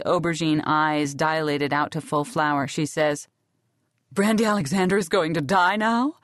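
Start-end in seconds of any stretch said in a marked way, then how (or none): 4.1-6.2 Brandy's dazed voice. Acted. Brandy, wounded, speaks of herself.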